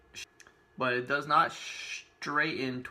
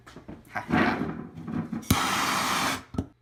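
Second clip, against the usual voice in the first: breathy laugh; 4 dB louder than usual. Real, not acted. About to use up the balm.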